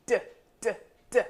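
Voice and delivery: crisp repeated d sound, short and clear. Shown as a demonstration. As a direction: tap out the letter sound d, d, d. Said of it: "it makes a sound like this".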